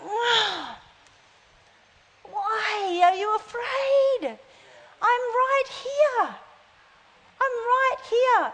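put-on a father God gently soothing a scared child; God's gentle voice. God reassuring his frightened child, softly and tenderly. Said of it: "he must sit in heaven and just go".

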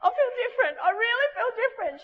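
high, giddy imitation of a girl astonished; amazed. Girl telling the preacher she feels different after prayer, high giddy amazement. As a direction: act out wonder bubbling up, very high. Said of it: "She's like this".

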